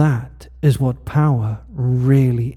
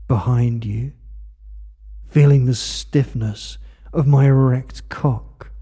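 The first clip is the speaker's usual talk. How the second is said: ordinary talk, nothing marked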